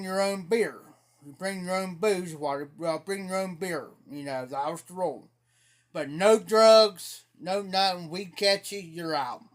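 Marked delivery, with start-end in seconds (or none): 5.9-7.2 slow, loud and firm on no drugs; firm emphasis. Retold or imitated. Host stating the house rule to partygoers, slow and firm. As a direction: lay down the law on drugs.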